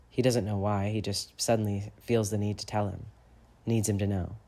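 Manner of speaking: ordinary delivery, nothing marked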